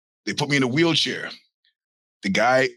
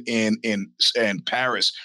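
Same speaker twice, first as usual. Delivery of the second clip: usual speaking voice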